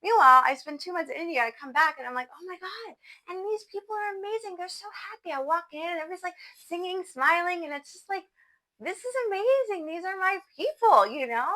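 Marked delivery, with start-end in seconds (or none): 2.3-5.3 high, soft gushing wonder; breathless amazement at new friends; awed delight. Retold or imitated. Back in Paris meeting kirtan people, high hushed awe. 8.8-11.1 high, bright joyful cry; high excited gush about finding her people; delighted exclaim. Retold or imitated. Describing walking into the kirtan, high joyful exclaim.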